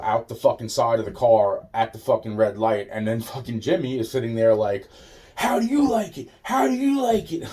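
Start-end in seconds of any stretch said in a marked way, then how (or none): 5.4-7.4 much higher mocking singsong; mocking taunt. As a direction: taunt him in a high mocking voice. Jimmy taunting him while he's sick.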